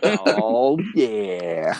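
hammed-up croon sliding down low; mock sultry. Player joins in the joke flirting, mock sultry. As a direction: echo the seducer, sinking into a low purr.

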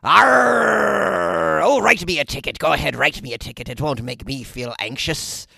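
put-on slow, drawn-out pirate accent; hammed-up pirate talk. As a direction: roll out long arrs like a stage pirate. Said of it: "When I, I talk like a pirate".

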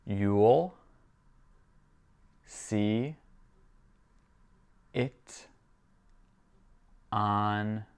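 very slow, long pauses between single words; drawn-out language-drill dictation. Shown as a demonstration. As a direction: give each word separately for a learner. Teacher repeats it word by word.

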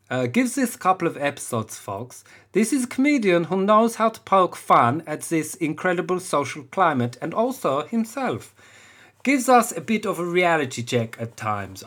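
light mock German accent on a review; reading a fan review in a hint of German accent. Comedian reading a podcast review in a light German accent. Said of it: "I'm gonna go Otto is a German".

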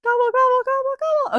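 high gobbling turkey call. Pretend. Reader demos turkey gobble.

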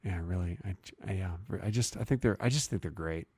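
very soft, low and hushed; mock intimate murmur for the listener. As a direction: drop to a close, secret hush. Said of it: "why are you getting so quiet?".

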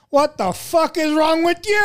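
high angry yell at the soaked cook; old manager yelling. Retold or imitated. Manager yelling at the chili-soaked cook, high angry shout. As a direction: bark it out high and outraged.

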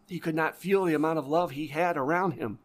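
ordinary delivery

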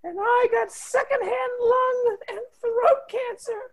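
put-on high, crying child voice; whiny child. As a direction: wail it like a pitiful sick kid. Melodramatic sick child pleading.

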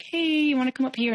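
high, lilting re-enacted invitation; coaxing, retold. Girlfriend texts sulking boyfriend from upstairs, high coaxing retelling.

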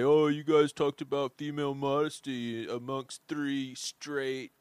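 mock someone who disapproves of you, loudly; critic impression. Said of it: "doing an impression about somebody that doesn't like us".

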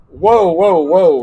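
loud, high, drawn-out whoas; astonished whoa. Retold or imitated. Vice President Gore cutting him off, loud and astonished. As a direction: stop him short in amazement. Retelling Gore's surprised reaction.

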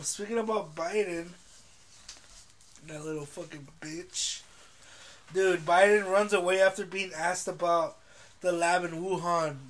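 usual speaking voice

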